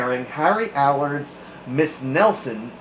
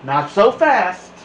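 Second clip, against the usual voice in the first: louder, higher warning call; big kid warning; 6 semitones higher, 7 dB louder than usual. Acted. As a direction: stop them short, big kid style. Big kid warns the class.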